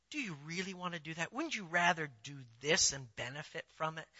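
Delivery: low, very soft coaxing; soft, low-pitched insinuation; hushed tempter. Acted. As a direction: coax quietly, like the voice of temptation. Voices the tempting satanic voice.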